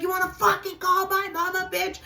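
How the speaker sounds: high-pitched re-enacted fury; enraged shriek. Tanya defending her mama mid-fight, enraged shriek. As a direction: screech it back in outrage.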